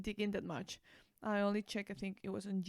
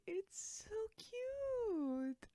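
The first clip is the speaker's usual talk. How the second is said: gushing; 12 semitones higher, much slower than usual. Real, not acted. Gushing over a favorite song.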